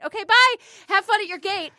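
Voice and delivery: suddenly high sing-out farewell; chirpy mock goodbye. Retelling her goodbye to the cowboy, high and chirpy. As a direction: flip up into a breezy send-off. Retold hurried goodbye after flirting.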